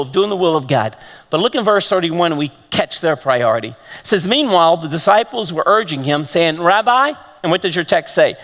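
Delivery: ordinary talk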